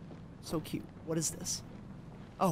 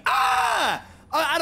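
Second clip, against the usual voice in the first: screaming in fear; 9 semitones higher, 6 dB louder than usual. Real, not acted. Startled by headless woman.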